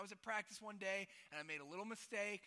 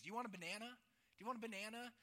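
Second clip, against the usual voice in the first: light mock Croatian accent, questioning lilt; imitated Croatian accent; 6 semitones higher than usual. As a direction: imitate a Croatian coach needling a player. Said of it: "In his thick Croatian accent".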